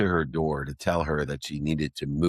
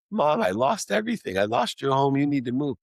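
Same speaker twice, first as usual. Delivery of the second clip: tearful blurt; 8 semitones higher than usual. Retold or imitated. Confessing to his mom he lost her home, high tearful blurt. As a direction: blurt it out between sobs like a child. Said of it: "like a four-year-old crying".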